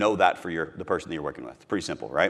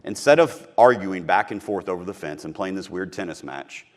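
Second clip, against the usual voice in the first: ordinary talk, nothing marked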